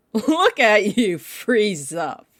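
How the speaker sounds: slow, mocking words through a laugh; laughing taunt. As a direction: laugh at the frozen hunter as you speak. Vampire laughs at frozen hunter.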